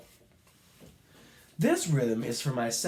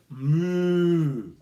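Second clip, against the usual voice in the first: a long, loud, drawn-out cow moo; 3 semitones higher, 7 dB louder than usual. Shown as a demonstration. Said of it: "What type of sound did the cow make?".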